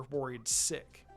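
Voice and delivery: usual speaking voice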